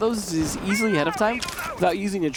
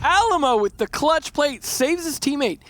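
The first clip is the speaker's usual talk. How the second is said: excited; 9 semitones higher, 3 dB louder, slower than usual. Real, not acted. Laughing excited call of play.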